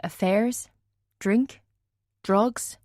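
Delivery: usual speaking voice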